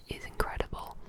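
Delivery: ordinary talk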